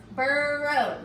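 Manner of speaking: "burrow" with a held er, burrr-row; drawn-out er. Shown as a demonstration. Teacher showing pupils the R, "burrow" sounded out slowly. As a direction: stretch the er in "burrow", then land on row. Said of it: "See how that R is there?".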